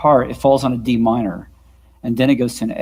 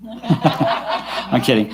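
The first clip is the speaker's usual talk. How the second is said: laughing; 4 dB quieter, much slower than usual. Real, not acted. Joking reply with laughter.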